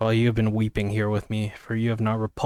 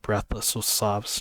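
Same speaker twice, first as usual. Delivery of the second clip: plain reading voice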